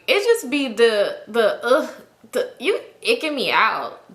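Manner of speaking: slow drawn-out disgust. Real, not acted. Teller grossed out by cocky dudes, slow and disgusted. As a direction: let the ick drag out.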